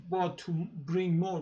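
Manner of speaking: usual speaking voice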